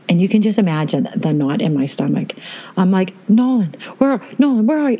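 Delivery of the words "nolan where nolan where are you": screaming his name. Retold or imitated. Mother searching for her missing son, panicked calling. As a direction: call out for a lost child in alarm. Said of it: "I'm, like, screaming his name".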